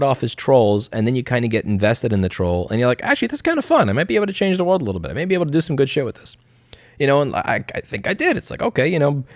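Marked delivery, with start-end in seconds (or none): 3.0-6.4 high and fast, getting into it; fast, high mock excitement; mock enthusiasm. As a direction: rush it like a sudden realisation. Voiced inner thought, hooked.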